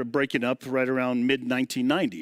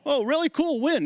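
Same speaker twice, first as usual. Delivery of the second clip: much higher, eager questioning; excited retold reply; 12 semitones higher than usual. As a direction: imitate an eagerly pleased reaction. Retold reply to tour offer.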